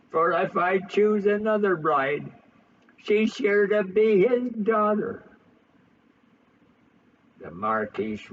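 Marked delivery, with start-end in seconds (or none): 0.1-5.2 higher, louder anguished lament; hammed-up heartbroken son; despairing cry. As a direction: wail it out in youthful despair. Hungry's despair at the revelation.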